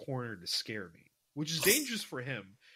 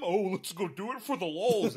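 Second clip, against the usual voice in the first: mocking friend voice; 6 semitones higher, 5 dB louder than usual. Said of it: "But by warning them".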